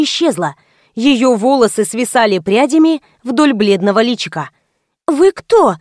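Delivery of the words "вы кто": higher-pitched startled question; surprised exclamation. A narrator's reading. Girl to an unexpected stranger, surprised. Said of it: "с удивлением воскликнула девушка".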